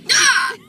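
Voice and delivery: high quacking hiccup; duck call hiccup. Real, not acted. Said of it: "You sound like a duck call".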